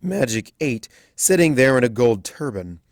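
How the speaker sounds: ordinary delivery, nothing marked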